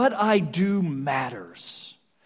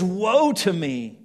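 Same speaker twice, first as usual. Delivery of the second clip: slow then louder, higher cry of woe; 5 semitones higher, 7 dB louder, slower than usual. A narrator's reading. Preacher voicing Isaiah's cry of despair, loud. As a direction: cry out in grief before God. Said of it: "I cried".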